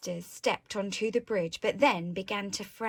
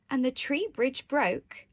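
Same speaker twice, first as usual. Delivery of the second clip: plain reading voice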